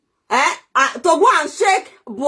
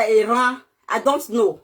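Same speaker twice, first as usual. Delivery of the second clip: usual speaking voice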